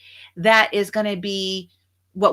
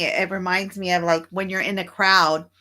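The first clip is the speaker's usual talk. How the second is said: normal talking voice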